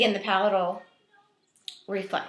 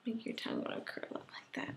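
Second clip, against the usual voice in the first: whispering; 15 dB quieter than usual. Real, not acted. Quiet coaching during the swab.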